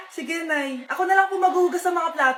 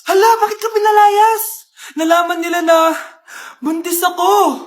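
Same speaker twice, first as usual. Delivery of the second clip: usual speaking voice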